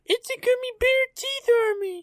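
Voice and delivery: very high squeaky loud cartoon voice; squeaky threatening candy creature voice; gummy bear army. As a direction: play a tiny menacing gummy bear soldier.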